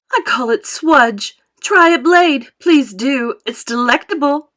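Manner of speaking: loud, animated boasting with big emphasis; proud factory owner in full flow; Wonka, exuberant showman. Acted. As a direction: show off your wonder with swelling excitement. Voices Wonka.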